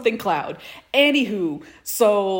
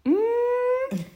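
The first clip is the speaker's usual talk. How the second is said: ordinary talk, nothing marked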